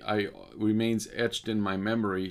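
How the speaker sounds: ordinary delivery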